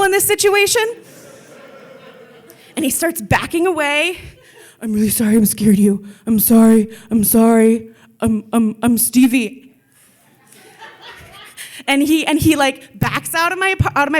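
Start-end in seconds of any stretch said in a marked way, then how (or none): 4.8-9.6 louder, flustered dopey thug apologising; mock scared thug, hammed up; dumb thug voice. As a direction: dim tough guy babbling sorry. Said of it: "it's my dumb thug voice".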